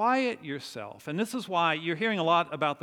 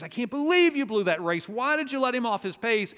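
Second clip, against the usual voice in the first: higher, louder angry trainer yelling at the jockey; retold angry outburst; 7 semitones higher, 4 dB louder than usual. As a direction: scold him for blowing the race, raised voice. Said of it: "Tom is so angry at Red".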